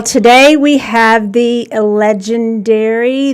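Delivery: slow, drawn-out showman's announcement; grand introduction. Real, not acted. Host announcing the legendary guest, slow and grand. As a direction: build up the guest's name. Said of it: "I didn't say that as fancy as I want".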